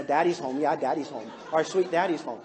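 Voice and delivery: low mock-meek chant; cowed kids, mock. Kids shaking in their rooms, mock-cowed low greeting. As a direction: lightly imitate cowed kids, pitched low. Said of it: "Everybody shaking in their rooms".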